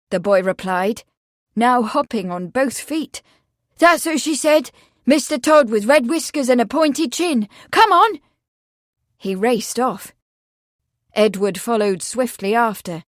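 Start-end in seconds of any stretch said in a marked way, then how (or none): 3.8-8.2 put-on high, loud, eager boy; excited. A narrator's reading. The boy hops and hurries the constable, excitedly. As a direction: rattle off proof, then urge loudly. Boy hopping, urging them on.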